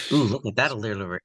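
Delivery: ordinary delivery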